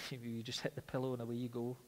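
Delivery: ordinary delivery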